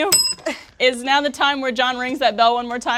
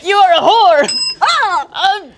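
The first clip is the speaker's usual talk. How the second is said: much higher and louder mock duel yell; hammed-up shouting; 9 semitones higher, 7 dB louder than usual. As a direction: shout it grandly across the duel.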